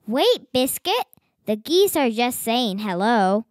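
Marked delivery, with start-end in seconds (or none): none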